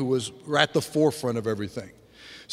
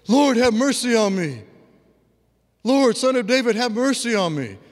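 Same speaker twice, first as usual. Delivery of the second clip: higher, louder imitated cry for mercy; imitating a plea; 8 semitones higher, 5 dB louder than usual. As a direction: plead out like the blind man in the video. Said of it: "That doesn't sound like a crow".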